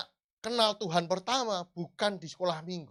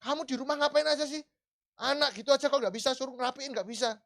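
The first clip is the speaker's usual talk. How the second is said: a husband scolding his wife, higher and louder, rushed; angry husband; 8 semitones higher, 4 dB louder than usual. Retold or imitated. Husband scolding his wife about the messy house, angry. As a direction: snap at her as the fed-up husband. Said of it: "Lah, waktu marah".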